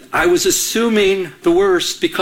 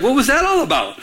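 retold jealous challenge, pitched high; mock confrontation, demanding an answer; confrontational; 7 semitones higher than usual. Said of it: "I confronted her right away".

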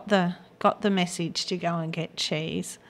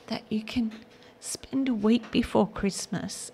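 normal talking voice

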